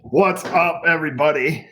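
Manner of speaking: high, lively show opener; enthusiastic greeting. Shown as a demonstration. Dad showing how to greet viewers, enthusiastic.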